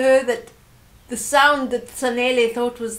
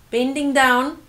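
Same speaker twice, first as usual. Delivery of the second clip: ordinary delivery, nothing marked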